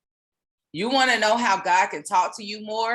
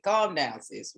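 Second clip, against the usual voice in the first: usual speaking voice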